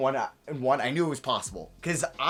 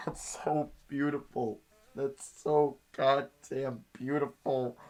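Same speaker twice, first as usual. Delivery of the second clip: slow awe; slower than usual. Real, not acted. Moved by the result.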